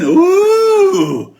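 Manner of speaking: ordinary delivery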